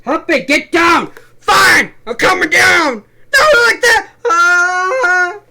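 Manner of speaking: loud, high, frantic yelling; shouting. Acted. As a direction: yell it like a panicked guard. Acted nursery rhyme brawl.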